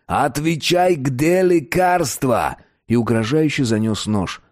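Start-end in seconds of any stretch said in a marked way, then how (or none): 0.1-2.6 higher, drawn-out threatening cry; shouted demand. A narrator's reading. Satan demands answers holding a knife, shouting. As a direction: cry the question out slowly and threateningly. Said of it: "и крикнул".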